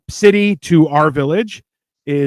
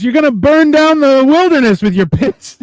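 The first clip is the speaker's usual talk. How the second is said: imitated yell; 9 semitones higher, 4 dB louder than usual. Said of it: "He's like".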